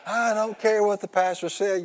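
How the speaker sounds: mock the convert's stubborn protest; indignant church lady. Convert defying the other lady, mock indignant. Retold quarrel.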